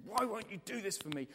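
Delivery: high-pitched acted anger; angry protest. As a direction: protest to Jesus in frustration. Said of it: "we get so angry with Jesus".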